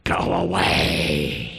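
low, slow, otherworldly demon cry; a low, drawn-out demonic cry. Acted. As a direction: growl it out like a demon. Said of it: "he cried out at the top of his voice".

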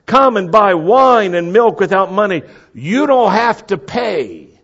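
high, full-voiced proclamation; crying out, stretching the last words; loud calling out. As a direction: shout the offer to all comers. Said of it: "this loud calling out".

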